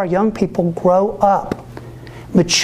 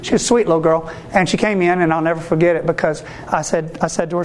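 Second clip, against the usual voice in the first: usual speaking voice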